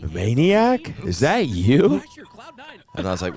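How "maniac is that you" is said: high, loud, drawn-out disbelief; drunk excited fan. Retold or imitated. Drunk fan spots his idol across a group, imitated excited shriek. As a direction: squeal it like a starstruck drunk fan. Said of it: "he was fucked up".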